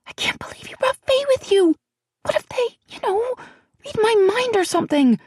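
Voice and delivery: put-on high, hushed and anxious young woman; a nervous friend fretting in a half whisper; frightened young woman. A narrator's reading. Celianne, frightened, voiced by narrator.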